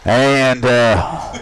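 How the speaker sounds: usual speaking voice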